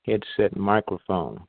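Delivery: ordinary talk, nothing marked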